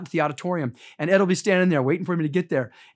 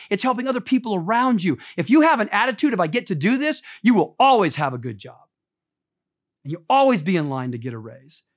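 higher, louder preacher's delivery, driving each line; preaching at full force; 6 semitones higher, 5 dB louder than usual. Acted. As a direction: preach it out to the congregation. Preaching to the congregation again.

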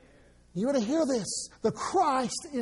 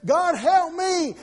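slow, louder cry of "God, help me"; desperate plea; 5 semitones higher, 6 dB louder, slower than usual. Retold or imitated. Sinner crying to God, slow and pleading. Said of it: "quote, God will listen to is".

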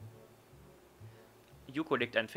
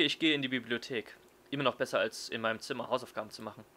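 usual speaking voice

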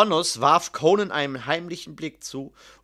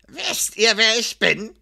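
menacing question, raised in pitch; drohend; 6 semitones higher, 4 dB louder than usual. A narrator's reading. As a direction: loom over them with the question. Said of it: "fragte er drohend". (drohend gefragt)

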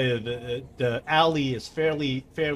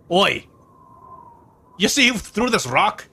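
gruff guard voice; 10 semitones higher, 3 dB louder than usual. Acted. GM voices guard confronting players.

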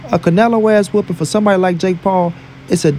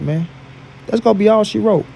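ordinary talk, nothing marked

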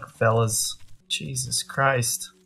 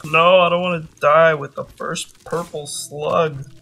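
higher and louder desperate wail; desperate plea; 5 semitones higher, 8 dB louder than usual. Real, not acted. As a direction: plead loudly not to die. Desperate not to die.

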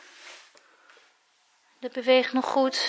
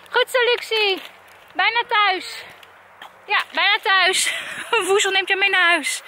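high, sing-out praise; encouraging call; 7 semitones higher than usual. Real, not acted. Vlogger cheering Luxie on towards home, high encouraging call.

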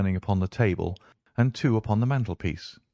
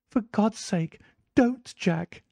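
wife crying out; 12 semitones higher, slower than usual. A narrator's reading. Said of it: "she cried".

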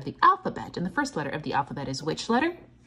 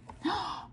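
sharp high gasp of delight; surprised gasp; 13 semitones higher, 6 dB quieter than usual. Real, not acted. Teacher gasping at a silly pattern, delighted gasp.

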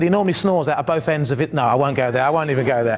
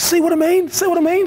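exasperated; 10 semitones higher, 4 dB louder than usual. Retold or imitated. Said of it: "And Roger goes".

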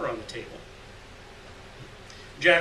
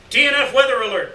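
loud, high radio dispatcher blaring; crackling alert over a receiver, loud and high; 8 semitones higher, 10 dB louder than usual. Acted. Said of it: "blared the receiver".

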